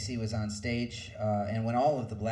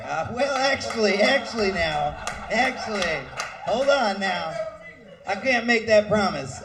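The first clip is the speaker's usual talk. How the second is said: higher, loud teasing take-back; mock backpedaling; 9 semitones higher, 7 dB louder than usual. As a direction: back out of the promise with a grin. Joke walking back a promise.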